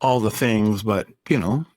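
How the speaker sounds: usual speaking voice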